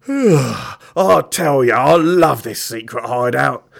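big breath, then loud, drawn-out yawned words; yawning. Acted. Mister Gum lying on the sofa, yawning contentedly. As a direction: stretch the words through a yawn. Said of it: "yawned Mister Gum".

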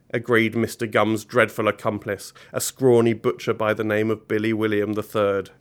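plain reading voice

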